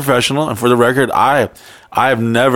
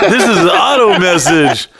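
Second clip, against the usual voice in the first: laughing; 6 semitones higher, 3 dB louder than usual. Retold or imitated.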